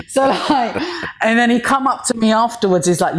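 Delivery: ordinary talk, nothing marked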